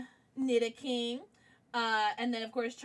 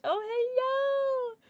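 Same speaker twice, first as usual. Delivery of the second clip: adoring coo; 12 semitones higher, much slower than usual. Real, not acted. Streamer saying hello to a plushie, high adoring coo.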